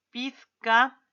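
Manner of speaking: normal talking voice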